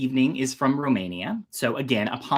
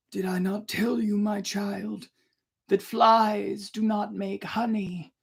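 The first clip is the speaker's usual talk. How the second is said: soft chiding father; 6 semitones higher, 4 dB quieter than usual. A narrator's reading. Emperor scolds gently.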